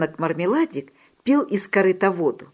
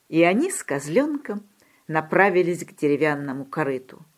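ordinary delivery, nothing marked

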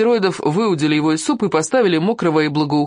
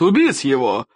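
sharp burst of fury, slightly raised; yelled order; 2 semitones lower than usual. A narrator's reading. Enraged chieftain ordering the boy killed, yelled command. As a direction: fire out the command angrily. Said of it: "заорал он".

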